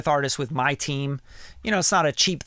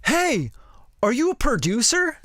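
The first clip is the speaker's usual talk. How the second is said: a fan calling out, voice jumping higher; bright eager stranger recognizing him; excited fan; 9 semitones higher than usual. Retold or imitated. A young fan at a restaurant calls out to him, eagerly. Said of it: "he was like".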